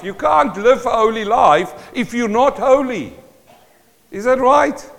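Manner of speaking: ordinary talk, nothing marked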